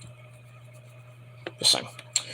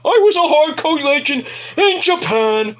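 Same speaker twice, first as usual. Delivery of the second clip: mock Terry Funk; 8 semitones higher than usual.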